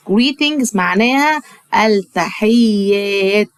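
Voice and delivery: slow, deliberate greetings then meaning; slow teaching. Shown as a demonstration. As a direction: spell it out slowly for first graders. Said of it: "قول بقى معايا".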